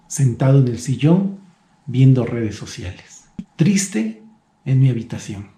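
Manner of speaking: ordinary talk, nothing marked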